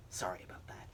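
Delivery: hushed, sheepish whisper; embarrassed whisper. Acted. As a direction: mutter the apology under your breath. Embarrassed aside after bad pun.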